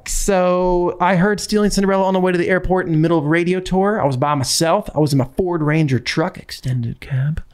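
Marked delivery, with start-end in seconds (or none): none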